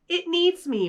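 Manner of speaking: put-on high, bright little boy voice declaring it happily; joyful boy, pleased with his find; happy declaration. A narrator's reading. Boy lifting the egg, happily declaring. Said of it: "Max declared happily".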